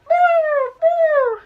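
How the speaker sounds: pinched nose wail; pinching nose. Shown as a demonstration. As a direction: hold your nose and sing out boo. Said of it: "Everybody do man overboard with me".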